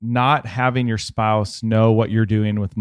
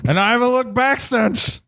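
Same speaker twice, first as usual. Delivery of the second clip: booming tipsy uncle, higher; proud tipsy uncle bragging; drunk uncle voice; 10 semitones higher than usual. Pretend. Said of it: "the drunk uncle".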